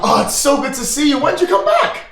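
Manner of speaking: high, delighted innkeeper voice; cheery barkeep. Acted. Barkeep happily greets his returning old friend. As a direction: gush over a long-lost friend.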